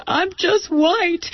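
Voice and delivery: put-on high, slow, tearful child's voice; tearful little boy. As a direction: mimic a boy on the verge of tears. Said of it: "burst into tears and said".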